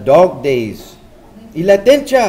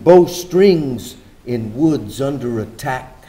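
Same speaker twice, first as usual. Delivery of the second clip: poem reading; 3 dB quieter than usual. Acted. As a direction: read it like a poem about the city. Poetic narration between speakers.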